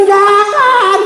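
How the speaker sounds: high, drawn-out cry of objection; exclaimed, stretched-out plea to stop; retold alarmed protest. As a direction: call it out high and long. Retold protest at running away.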